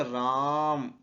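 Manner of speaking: राम with its आ held long and slow; राम said slowly, vowel prolonged; drawn-out Ram. Shown as a demonstration. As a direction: stretch राम like a called-out name. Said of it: "ज्यादा देर तक उच्चारण हो रहा है".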